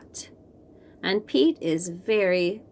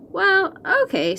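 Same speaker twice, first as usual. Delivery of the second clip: Pete agreeing; 11 semitones higher, 3 dB louder than usual. Acted. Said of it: "let's try that again".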